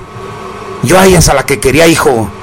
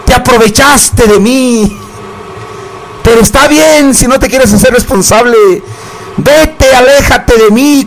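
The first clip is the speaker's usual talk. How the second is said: high, slow melodramatic plea; dramatic lovelorn plea; 5 semitones higher, slower than usual. Retold or imitated. Grandma echoing [name], hammed-up heartbroken plea. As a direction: play it like a heartbroken ballad. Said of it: "como dijo [name]".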